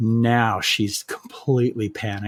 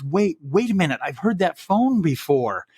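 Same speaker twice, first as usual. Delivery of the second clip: dawning recognition; 6 semitones higher than usual. Retold or imitated. Killer recognizes the ringing phone.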